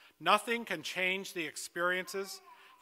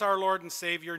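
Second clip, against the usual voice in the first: ordinary delivery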